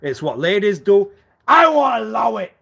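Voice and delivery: high, loud, indignant mimicry; loud mock outrage. Mocking the ladies' excuse with a loud, high outburst. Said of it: "And you know why I will talk like that then".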